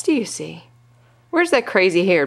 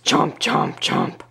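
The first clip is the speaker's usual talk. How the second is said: low, partly voiceless chomps, dropping deeper; shark chomping; much slower than usual. Pretend. Parent voices the shark, low chomping. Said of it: "Shark says".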